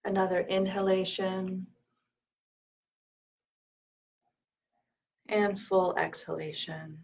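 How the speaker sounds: calm meditation leader, leaving room to breathe; guided meditation. Acted. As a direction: lead them gently through the breath, unhurried.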